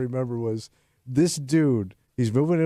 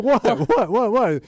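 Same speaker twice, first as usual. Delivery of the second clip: put-on defensive boyfriend; 9 semitones higher, 5 dB louder, slower than usual. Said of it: "he's like".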